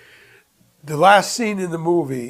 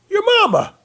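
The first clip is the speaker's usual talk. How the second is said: punchline; 7 dB louder, faster than usual. Retold or imitated. Lawyer's punchline.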